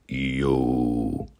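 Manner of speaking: very low, drawn-out shadow-man greeting; deep, ghostly put-on voice; spooky voice. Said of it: "a response from a spooky voice".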